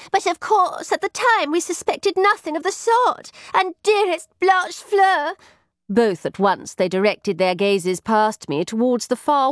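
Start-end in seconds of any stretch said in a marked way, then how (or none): 0.1-5.3 high-pitched, indignant gossip; fretful lady in waiting. Acted. Ladies in waiting recount the old beggar to their visitor, high and fretful. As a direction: voice an agitated lady's maid up high.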